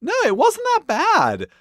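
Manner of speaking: normal talking voice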